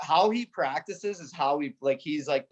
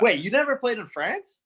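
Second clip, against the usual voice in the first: surprised; 7 semitones higher, 3 dB louder than usual. Real, not acted. Learns teammate never played France.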